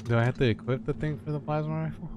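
usual speaking voice

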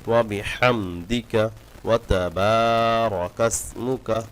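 ওয়া তাবারাকা with the বা stretched one alif; slow, careful tajweed reading of ওয়া তাবারাকা; recitation demo. Shown as a demonstration. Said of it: "বাতে এক আলিফ টান আছে". (বাতে এক আলিফ টান দিয়ে পড়া)

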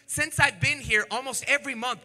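normal talking voice

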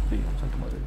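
breathless scared whisper. Pretend. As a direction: hush it, afraid of being heard. Susurro asustado en el juego. (susurro asustado)

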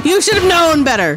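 loud high gloating taunt; hammed-up gloat. Wrestler gloating over the beaten rival, loud high taunt. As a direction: shout it down at the loser. Repeated louder, higher.

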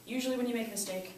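usual speaking voice

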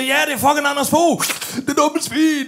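excited yell. Retold or imitated. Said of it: "Og jeg siger".

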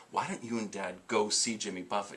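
soft, low suggestion to a parent; gentle coaxing. Retold or imitated. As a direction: re-enact patiently persuading Mom. Coaxing his mother, retold.

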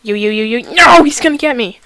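panicking gamer. Real, not acted. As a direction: cry out in a panic. Gamer about to be caught.

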